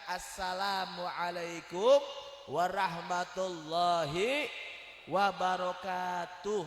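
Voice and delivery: slow, loud, every syllable clear; clear full salam. Shown as a demonstration. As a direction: say the whole greeting slowly and fully. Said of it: "biar jelas". (salam lengkap, pelan dan jelas)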